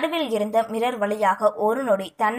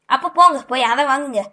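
fast, louder irritated snap; irritated order; 3 semitones higher, 7 dB louder, faster than usual. Acted. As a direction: brush them off impatiently. Annoyed Yuva sends women away.

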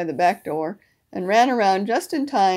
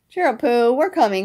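loud, higher cheery call; 3 semitones higher, 6 dB louder than usual. A narrator's reading. Friends coming to rescue stuck Pooh, calling out. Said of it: "called a voice".

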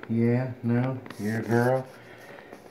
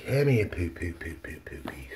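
whispered coaxing. Real, not acted. Owner coaxing a sleepy puppy, whispered baby talk. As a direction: whisper to coax the puppy over.

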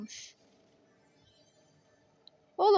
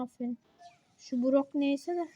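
ordinary talk, nothing marked

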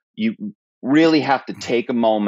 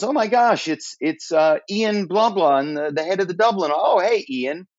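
much higher-pitched, acted surprise; surprised executive; 8 semitones higher than usual. US executive greeting a supposed Dublin colleague, in high-pitched mock surprise. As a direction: imitate a flustered executive recognizing a caller.

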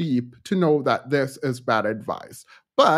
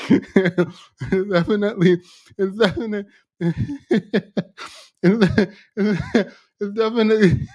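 cracking up, unable to finish; words keep collapsing into real laughter; helpless laughter; 4 semitones higher than usual. Real, not acted. Streamer mocking a beige video to viewers, helplessly laughing.

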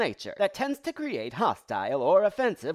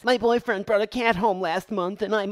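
ordinary delivery

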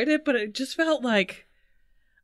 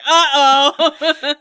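laughing mock alarm; 3 semitones higher, 6 dB louder than usual. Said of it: "They're like".

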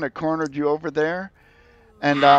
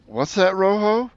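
high curious creature; 8 semitones higher than usual. Acted. Yellow-furred creature asks.

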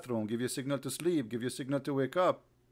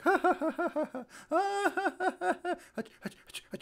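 very high cackling fake laugh; mock hysterical laughter. Imitating Allah dying of laughter at a sneeze, mock hysterical laughter. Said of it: "die from, like".

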